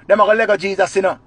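usual speaking voice

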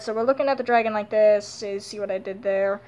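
ordinary talk, nothing marked

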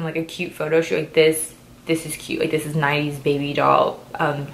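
usual speaking voice

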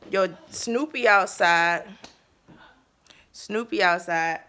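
loud, slow drawn-out call. Real, not acted. Daughter calling out that Snoopy is outside, loud and slow. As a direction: announce it loudly across the house.

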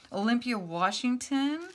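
ordinary talk

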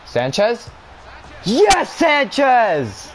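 high, drawn-out cheering shout; excited goal shout. Real, not acted. Gamer cheering his striker's goal, drawn-out joyful shout. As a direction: cheer the goal like a fan in the stands.

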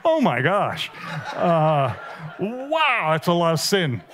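amused, laughing reaction; laughing Jesus. Retold or imitated. Jesus laughing at the preacher's pile of sin. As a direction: laugh it off with fond disbelief. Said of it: "I always imagine Jesus going".

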